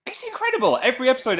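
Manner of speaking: very high squeal of enthusiasm; gushing excitement. Real, not acted. Streamer praising a favourite show, excited squeal. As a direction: let the voice shoot right up in delight.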